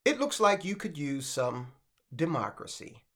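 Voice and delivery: low, soft veiled threat; lightly acted menace, understated and low. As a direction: drop it low and quiet, an implied warning. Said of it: "interpret that as him saying".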